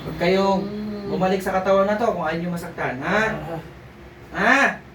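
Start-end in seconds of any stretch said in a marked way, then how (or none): 4.4-4.8 forceful command. Acted. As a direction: force the spirit out. Healer commanding spirit out.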